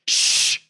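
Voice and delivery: sharp unvoiced shush; librarian shush. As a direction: shush them like a strict librarian. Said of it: "shushes you".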